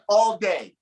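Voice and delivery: normal talking voice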